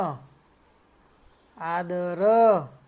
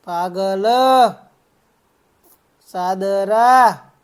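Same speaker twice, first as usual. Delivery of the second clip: louder, single words for repeating; loud word drill; 4 dB louder than usual. Shown as a demonstration. Teacher giving children words to repeat loudly. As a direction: call each word out firmly, pausing after it. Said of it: "જોરથી બોલવાનું છે".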